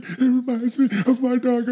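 high, slow, tearful whimper of a grown man; imitating a buddy crying over a dog movie; a high, slow, mock-weepy voice; mock sobbing.

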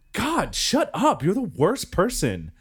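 high, slow, scornful mock girlfriend; mock annoyed girl. Imitating the annoyed moviegoer telling him off, high and scornful. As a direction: mimic her snapping at him, drawn out.

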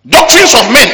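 loud, high pulpit shout; denouncing. Real, not acted. Preacher condemning human doctrines to the congregation, denouncing loudly. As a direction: hurl it out as an accusation.

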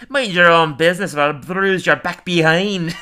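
loud, high Scottish scolding; cartoon Scot, slowing for the threat; Willie, Scottish accent. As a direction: threaten in a hammed-up Scottish brogue. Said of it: "I'm Scottish".

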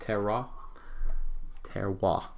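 quiet, careful French terroir; terroir, French pronunciation; French accent. Shown as a demonstration. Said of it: "I'll do the French".

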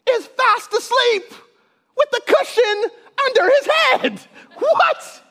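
high, drawn-out disbelieving cry; incredulous. Acted. Preacher telling the church Jesus slept through the storm, incredulous. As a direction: squeal it in amused disbelief.

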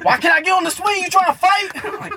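high, loud playground outburst; hammed-up yelled challenge; mock belligerent yell. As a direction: holler like a kid spoiling for a fight. Imitates Tony picking fight at park.

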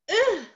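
high, loud grunt of pain; a sharp high groan of hurt; pained ugh. Pretend. Imagining the pain of clay.

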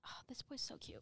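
soft whisper. Real, not acted. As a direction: whisper it to yourself. Whispering over a profile.

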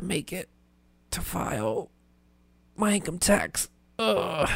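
hushed, strained dying-hero voice; mock-heroic movie hero; movie hero straining. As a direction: croak it out like a wounded hero pushing on. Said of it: "imagine it like in a movie".

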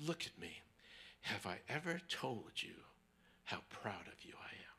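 soft, low, whispered pride; a hushed tender father; tender father's words. Retold or imitated.

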